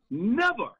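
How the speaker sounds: "Never." high and loud, falling; emphatic never. Real, not acted. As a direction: punch "Never." hard. Emphatic rhetorical answer.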